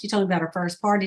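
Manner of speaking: ordinary delivery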